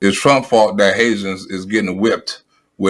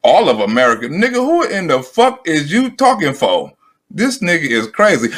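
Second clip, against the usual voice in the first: incredulous; 7 semitones higher than usual. Real, not acted. Incredulous reaction to speech.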